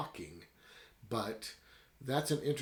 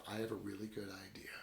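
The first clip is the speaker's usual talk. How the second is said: hushed whisper to his wife; 2 semitones lower, 10 dB quieter, faster than usual. Retold or imitated. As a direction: whisper it like a secret plan. Husband confides plan to wife.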